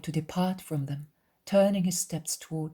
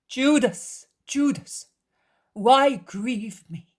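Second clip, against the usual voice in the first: raised, louder, drawn-out plea; pained reproach; 8 semitones higher, 8 dB louder, slower than usual. A narrator's reading. Jesus reproaching his cousin, pained appeal. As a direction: plead with him, stretching the last words.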